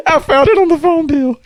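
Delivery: high, loud mock-mom voice; giggling mom. Mom confessing to her son, imitated giddy squeal. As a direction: blurt it through mimicked giggles.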